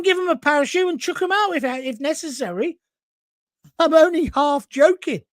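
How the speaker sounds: ordinary talk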